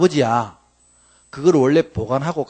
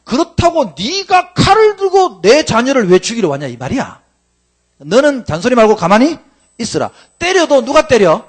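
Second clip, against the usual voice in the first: higher, louder stern rebuke; God rebuking; 8 semitones higher, 7 dB louder than usual. Acted. God scolding Laban for chasing Jacob, stern loud rebuke. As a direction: scold like an offended father.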